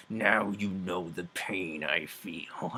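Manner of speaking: slow, drawn-out hammed-up vengeance; vengeful Roche. Roche to the king he stabs, mock vengeful. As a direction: draw it out with mock menace.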